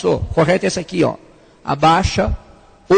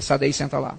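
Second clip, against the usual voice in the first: much softer, lower firm command; quiet stern order; 8 semitones lower, 10 dB quieter than usual. Retold or imitated. Pastor silently orders his sons from the pulpit, low and firm. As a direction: say it low without raising the voice. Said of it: "Eu dizia".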